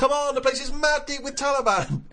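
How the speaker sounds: high, pumped-up DJ shout; DJ hype voice. Retold or imitated. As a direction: hype the crowd like a club DJ. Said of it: "Westwood's up there, going".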